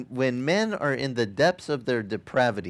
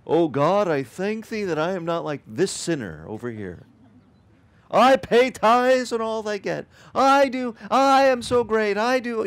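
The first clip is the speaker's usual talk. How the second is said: mocking the proud Pharisee; 7 semitones higher, 4 dB louder than usual. Preacher mocking the Pharisee praying in the temple, pompous and loud. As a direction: ham up a smug Pharisee bragging to God. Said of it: "the religious dude, he stood up there".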